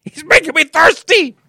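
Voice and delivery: high, loud mock Seinfeld; Seinfeld impression. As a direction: shout it as a Seinfeld bit. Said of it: "Terrible Seinfeld impressions".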